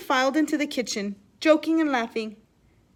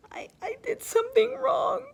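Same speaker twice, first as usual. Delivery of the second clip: high, broken sobbing confession; 13 semitones higher than usual. A narrator's reading. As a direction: stammer it out near tears. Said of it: "Maria sobbed".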